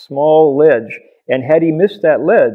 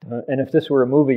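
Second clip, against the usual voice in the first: ordinary talk, nothing marked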